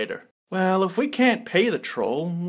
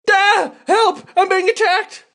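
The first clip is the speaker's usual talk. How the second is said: high, loud hammed-up panic; cartoon damsel shrieking for her knight; panicked cry for help; 11 semitones higher, 5 dB louder, slower than usual. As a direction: scream for rescue, overplayed. Character cries for help.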